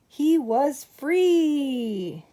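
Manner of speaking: high, slow, drawn-out triumphant cry; joyful release. A narrator's reading. Narrator celebrating George's escape, slow and joyful. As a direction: savour the escape.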